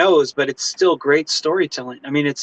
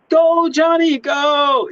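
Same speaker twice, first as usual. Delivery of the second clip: mock cheer like a parent on the sidelines; cheering on a kid; 11 semitones higher, much slower than usual.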